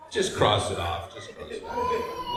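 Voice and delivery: ordinary talk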